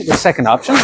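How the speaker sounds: normal talking voice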